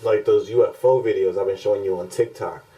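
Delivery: normal talking voice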